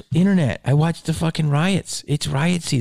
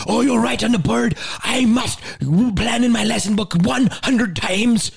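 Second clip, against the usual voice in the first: high, scolding half-Irish nun voice; mock an indignant nun handing out orders; stern Irish nun; 8 semitones higher than usual. Nun scolds her class over the lesson book, high mock Irish. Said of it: "like, a half Irish accent".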